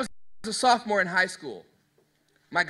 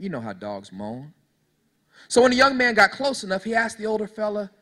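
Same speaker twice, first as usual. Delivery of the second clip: normal talking voice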